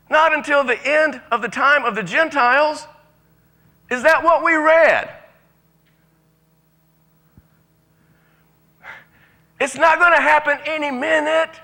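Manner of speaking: high, loud preaching declaration; preaching at full force. Real, not acted. Preacher declaring to the congregation, full force. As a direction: hammer it home from the pulpit.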